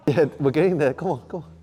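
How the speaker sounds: loud high coach cheering; shouting encouragement. Real, not acted. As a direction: push them through the last rep. Coach cheering client through set.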